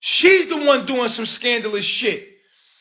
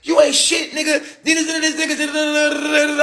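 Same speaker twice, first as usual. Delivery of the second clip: snapping; 8 semitones higher than usual. Retold or imitated. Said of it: "shorty going snapping".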